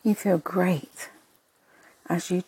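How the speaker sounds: soft unvoiced whisper. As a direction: whisper it gently. Whispered affirmation in meditation.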